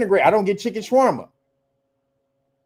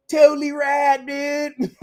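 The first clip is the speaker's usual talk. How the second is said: higher mock surfer bro; lightly put-on totally-rad voice; surfer dude impression; 7 semitones higher, slower than usual. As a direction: toss it off like a stoked dude. Mocking old surfer slang.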